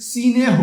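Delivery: সিনেহ said with an extra i before the n, si-neh; teacher demonstrating সিনেহ with a vowel slipped in; vowel inserted. Shown as a demonstration. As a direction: split the cluster of স্নেহ into সিনেহ. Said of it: "স্নেহ থেকে".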